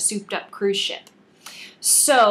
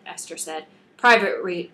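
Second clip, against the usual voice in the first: ordinary delivery, nothing marked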